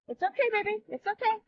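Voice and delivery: high, soft reassuring coo; calm a frightened pet; soothing a scared dog. Real, not acted. Drone struggling in gusts.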